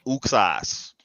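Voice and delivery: "Uxas" said OOK-sahs; as it's spelled. Shown as a demonstration. Host answering a name question, spelled-out OOK-sahs pronunciation. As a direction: say "Uxas" with an oo opening. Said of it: "just like how it's spelled".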